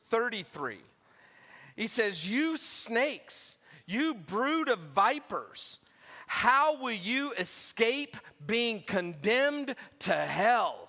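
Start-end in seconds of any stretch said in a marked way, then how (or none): 2.2-5.8 forceful preached scripture, slow on key words; scripture reading. Acted. Preacher reading Jesus' woes to the congregation, forceful. Reads Jesus' rebuke aloud. 6.3-10.8 slow, loud, preached higher; forceful rebuke. Shown as a demonstration. Preacher voicing Jesus condemning the Pharisees, loud and slow. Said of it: "Like, again, you just see".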